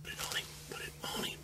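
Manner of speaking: hushed whisper in the blind; hunting whisper. Real, not acted. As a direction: whisper so the hog won't hear.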